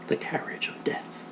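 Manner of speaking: father telling a ghost story, whispered; spooky whisper. Acted.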